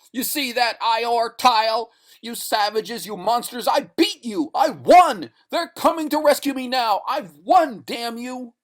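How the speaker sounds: high, loud victorious yelling; triumphant gloating. Acted. Stranded man gloating at the savages, triumphant yelling. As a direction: taunt them with your win.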